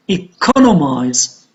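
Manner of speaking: "economize" with "con" stressed, then falling; stress on second syllable. Shown as a demonstration. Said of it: "on the second syllable".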